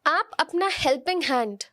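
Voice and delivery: ordinary talk, nothing marked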